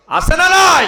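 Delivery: very high, loud cry; rallying shout. Real, not acted. Preacher demanding the crowd confirm it, rallying shout. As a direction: belt the question out to the crowd.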